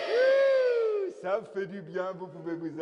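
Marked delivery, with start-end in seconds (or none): 0.1-1.1 clown whoop. Acted. Relief after crowd shout.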